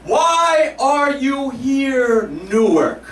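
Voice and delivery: very slow, high drawn-out question; Oprah to crowd. Retold or imitated. Oprah asking the Newark arena, very slow and high. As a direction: stretch every word out to the arena. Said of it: "there's Oprah the first night".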